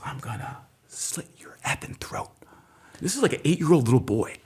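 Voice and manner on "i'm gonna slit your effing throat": whispered threat, breathy and cold; a child's eerie whispered threat; menacing whisper. Retold or imitated. Boy threatens him at dinner.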